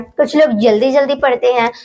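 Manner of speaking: ordinary talk, nothing marked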